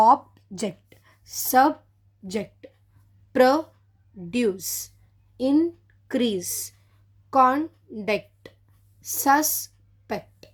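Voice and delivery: very slow, each word split into syllables; divided by syllable. Shown as a demonstration. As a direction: spell out object and subject syllable by syllable, slowly. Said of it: "divide చేసి పలకాలన్నమాట".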